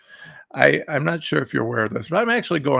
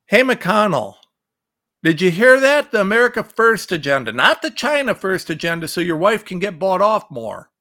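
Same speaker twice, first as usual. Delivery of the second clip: loud, higher, hammering at him; raised angry shout at a senator; angry rant; 3 semitones higher, 5 dB louder than usual. Real, not acted. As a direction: call him out, furious. Ranting at McConnell directly.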